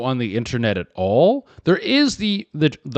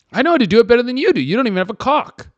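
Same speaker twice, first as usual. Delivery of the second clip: loud, high, rapid-fire retort; mock indignant; 9 semitones higher, 4 dB louder, much faster than usual. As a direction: play up the offended comeback. Acted indignant retort to porn performer.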